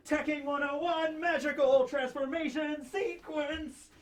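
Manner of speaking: high, slow announcer of a transformation; magical girl transformation. Pretend. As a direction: chant it like an anime transformation.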